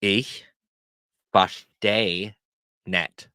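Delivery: each word separate, pauses between; word-by-word teaching demo. Shown as a demonstration. Teacher shows learners how to say they don't understand, word by word. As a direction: break it up slowly for learners. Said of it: "So you wanna say".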